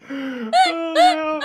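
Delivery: squeaky high-pitched laugh; helium laughter. Real, not acted. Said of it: "it's just helium laughter".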